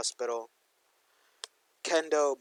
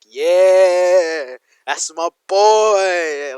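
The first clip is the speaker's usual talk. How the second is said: slow, much louder proud cheer; cheering yell; 5 semitones higher, 15 dB louder, much slower than usual. Acted. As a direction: holler from the back like a proud mentor. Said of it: "Kyoshi was yelling from the back".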